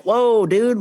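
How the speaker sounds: high, startled backing-off; retold alarmed protest; taken aback. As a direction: react to a sudden threat. Alarmed reply to a threat.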